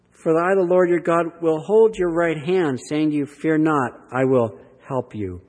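a measured, lifted reading of the verse; scripture reading. Acted. Preacher reading God's promise aloud to the congregation, measured and lifted.

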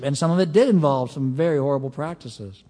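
ordinary talk, nothing marked

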